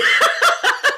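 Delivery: high-pitched burst of laughter; loud laugh. Real, not acted. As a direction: crack up loudly at the silly voice. Laughing at the mock French attempt.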